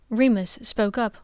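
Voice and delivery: plain reading voice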